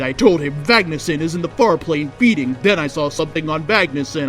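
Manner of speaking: plain reading voice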